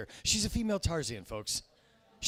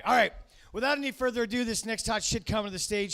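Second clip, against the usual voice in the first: host announcing; 7 semitones higher, 6 dB louder than usual. Real, not acted. Host introduces next act.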